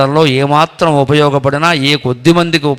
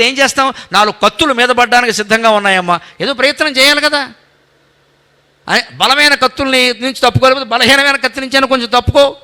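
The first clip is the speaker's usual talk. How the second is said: higher, insistent voice of Krishna advising Arjuna; Krishna exhorting Arjuna, higher pitched; Krishna urging; 7 semitones higher than usual. Acted. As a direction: push him to act, earnestly.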